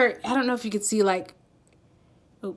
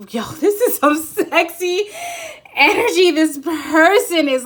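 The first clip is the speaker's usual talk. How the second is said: higher and louder, laughing delight; excited; 9 semitones higher, 8 dB louder than usual. Real, not acted. Reader thrilled by the card's energy, laughing. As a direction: gush with a giddy laugh. Gushing over sexy energy.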